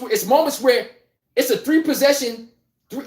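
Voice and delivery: ordinary talk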